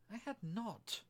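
high-pitched indignant cry; angry mouse, voice leaping up; sharp, very angry. A narrator's reading. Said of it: "cried the mouse sharply and very angrily".

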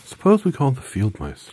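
put-on high, loud girl; Dorothy voice. A narrator's reading. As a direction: call out brightly as young Dorothy.

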